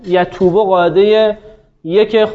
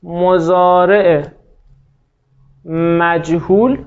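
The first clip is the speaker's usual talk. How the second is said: slow dictation; much slower than usual. Shown as a demonstration. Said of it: "اه، این فعل".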